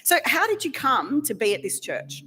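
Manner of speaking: usual speaking voice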